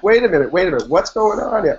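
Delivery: trying to take control in a panic; alarmed. Retold or imitated. Patient on the operating table, retold alarm.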